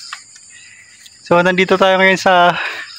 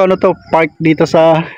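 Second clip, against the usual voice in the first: ordinary delivery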